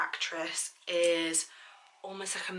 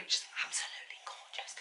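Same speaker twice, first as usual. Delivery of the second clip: softly whispered compliment; 12 dB quieter than usual. Real, not acted. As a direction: breathe it out in a whisper. Whispered aside while applying.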